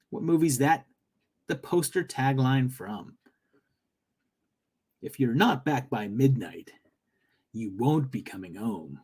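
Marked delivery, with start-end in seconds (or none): 5.0-9.0 low, ominous trailer voice; movie trailer guy voice. Acted. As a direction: read it like a movie trailer narrator. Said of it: "I should say it like movie trailer guy".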